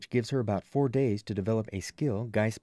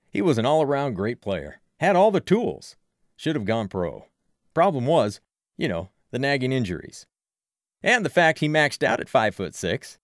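loud, emphatic sports fan; opinionated Frank; 7 dB louder than usual. A narrator's reading. Frank listing an old player's talents, loud and emphatic. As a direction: argue a player's merits like a loud local fan.

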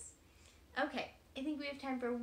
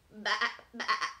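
loud bleating baa; sheep bleat; 3 semitones lower, 9 dB louder than usual. Acted. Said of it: "bleated the sheep".

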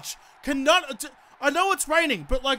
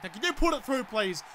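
ordinary talk

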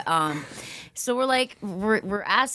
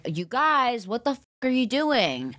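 higher, lightly voiced mom scolding; a hint of an exasperated mother; 8 semitones higher than usual. Mom catching the kids in the dark barn, mild mock scolding. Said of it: "she's like".

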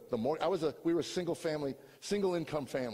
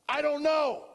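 anguished cry; 12 semitones higher, 7 dB louder, slower than usual. Real, not acted. Anguished answer, loud.

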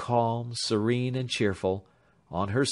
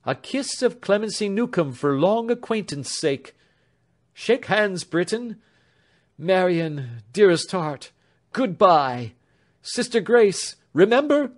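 fussing housemaid; 9 semitones higher, 5 dB louder than usual. A narrator's reading. Clemency says goodbye.

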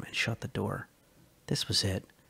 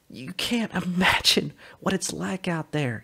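raised, urgent voice of a man desperate to escape; Sully's eager voice; 7 semitones higher, 3 dB louder than usual. Acted. Sully urging Calla to run away with him, excited and high. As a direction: push the plan on her, breathless with hope.

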